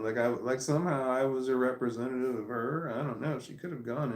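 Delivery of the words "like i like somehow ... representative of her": plain reading voice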